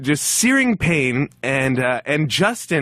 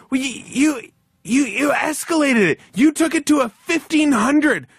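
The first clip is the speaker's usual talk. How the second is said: retold yelling; 11 semitones higher than usual. Coworker shouting back at the narrator on landing, high mock yell. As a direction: yell it back, tripping over the words. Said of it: "And he's going".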